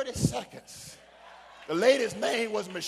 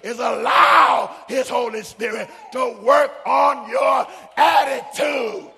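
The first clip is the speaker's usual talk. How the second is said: loud, driving sermon delivery; preaching at full force; 3 semitones higher, 12 dB louder, slower than usual. Acted. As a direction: preach it hard at the congregation. Preacher scolding congregation.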